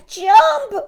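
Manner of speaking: very high, loud roar; a hammed-up captain's roar. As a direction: roar the order to jump. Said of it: "roared Earl".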